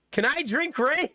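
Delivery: put-on high, bright little-kid question; imitating a toddler asking; curious toddler. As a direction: ask like an innocent three-year-old. Three-year-old asks innocently.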